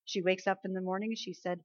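ordinary talk

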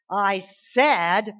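loud, emphatic insistence; forceful preaching; firm demand. Acted. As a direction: plant your feet and insist. Preacher repeats toddler demand.

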